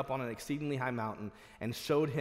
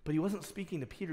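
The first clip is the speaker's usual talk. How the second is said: ordinary talk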